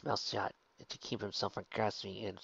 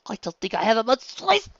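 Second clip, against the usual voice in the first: strained shout; 12 semitones higher, 13 dB louder than usual. A narrator's reading. Mother in labor.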